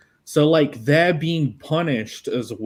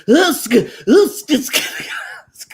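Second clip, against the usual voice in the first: Popeye grunts; 11 semitones higher, 7 dB louder than usual. Retold or imitated. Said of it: "What sounds do you think he makes".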